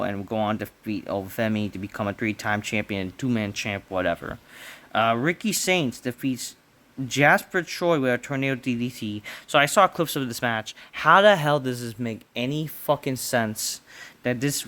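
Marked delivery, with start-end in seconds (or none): none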